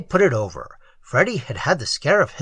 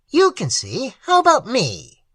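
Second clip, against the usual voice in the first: high, petulant complaint; whining at being pushed aside; indignant whine; 8 semitones higher than usual. Acted. Limerick complains, shoved aside.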